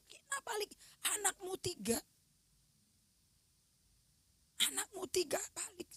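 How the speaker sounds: high, pleading whisper calling her back; begging her to come back, in a whisper; urgent whisper. Retold or imitated. Calling dying sister back, retold.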